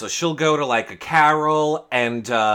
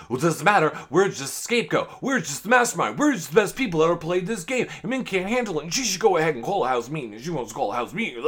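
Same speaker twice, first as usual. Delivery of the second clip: higher, sing-song run-on mimicry; higher blabbering imitation of a housemate; mocking rant; 5 semitones higher, much faster than usual. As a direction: rattle off excuses in a mocking lilt. Imitating Kyle's whiny self-defence rant.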